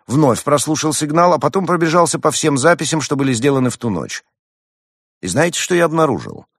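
high, loud, ringing testimony; Roman, confident young hedgehog. A narrator's reading.

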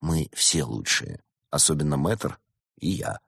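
low, soft and slow; quiet, intimate aside; confiding. A narrator's reading. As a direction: confide it slowly under your breath. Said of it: "доверительно произнёс".

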